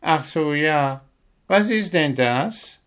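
high, sleepy, clueless owl; Willi, drowsy. A narrator's reading. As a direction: ask vaguely, still half-asleep. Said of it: "erkundigte sich Willi und gähnte".

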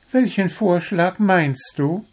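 high-pitched, puzzled bird voice; baffled little bird. A narrator's reading. Little bird questions the dwarf's remark, baffled. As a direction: ask it high, taken aback. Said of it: "war Zwitschi verblüfft".

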